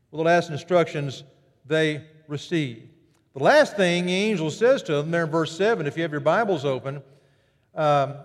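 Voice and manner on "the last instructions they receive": ordinary talk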